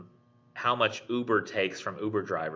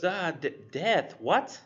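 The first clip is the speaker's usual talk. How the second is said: higher, stammering, puzzled question; startled confusion; 9 semitones higher, slower than usual. Pretend. Coworker puzzled by the word death, startled and high. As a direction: stumble over the word, baffled. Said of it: "The dude goes".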